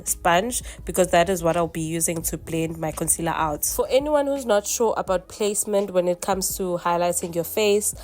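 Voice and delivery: ordinary talk, nothing marked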